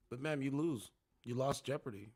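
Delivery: usual speaking voice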